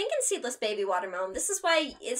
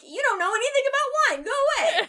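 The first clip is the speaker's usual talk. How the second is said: indignant retort; 14 semitones higher, 6 dB louder than usual. Retold or imitated. Retold retort to pushy wine guy.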